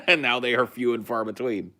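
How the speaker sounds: chuckling through the line; laughing. Real, not acted. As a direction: laugh while telling it. Sarcastic joke about vanishing birds.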